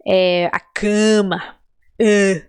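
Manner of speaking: "cama" slow with a heavily nasal a; exaggerated nasal a. Shown as a demonstration. Teacher exaggerates the nasal sound. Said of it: "Eu tô exagerando".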